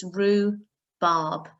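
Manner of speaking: "rhubarb" said slowly as roo-barb, no H sound; teacher sounding out "rhubarb" without the H; silent H. Shown as a demonstration. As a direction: draw out "rhubarb" with no H, oo in the middle. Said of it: "you don't pronounce the H".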